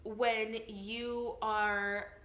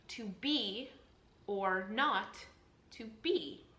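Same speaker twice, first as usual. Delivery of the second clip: "be", "not", "be" stressed, pausing after each pair; stressed iambs; slower than usual. Shown as a demonstration. Teacher showing meter in Hamlet's soliloquy, stress on "be", "not", "be". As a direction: split it in beats, landing on "be" and "not". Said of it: "That's what we're stressing".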